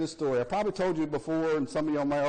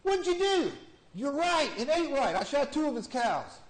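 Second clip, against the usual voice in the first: high voices; 11 semitones higher, 4 dB louder than usual. Acted. Punchline voices in joke.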